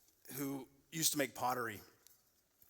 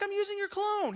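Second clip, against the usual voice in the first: much higher, sing-song innocent reply; innocent dad; 4 dB louder than usual. Retold or imitated. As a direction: act like it's no big deal. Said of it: "I'm like".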